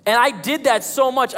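normal talking voice

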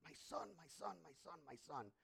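soft, hushed repeated endearment; tender father. Retold or imitated. Father kissing his returned son over and over, tender and soft. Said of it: "He's kissing him".

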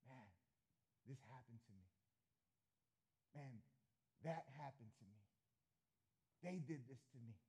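soft, hurt murmur; quiet brooding. Acted. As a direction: brood to yourself at night. Listener's night thoughts voiced.

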